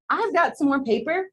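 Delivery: smiling exclaim. Acted. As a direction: pop up with fresh excitement. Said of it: "Red smiled and exclaimed".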